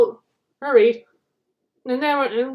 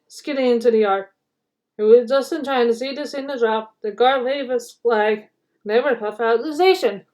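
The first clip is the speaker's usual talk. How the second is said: ordinary delivery, nothing marked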